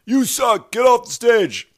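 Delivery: high, loud jeering heckle; mock heckler shouting at the stage; mock heckler yell. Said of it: "A heckler just doesn't go".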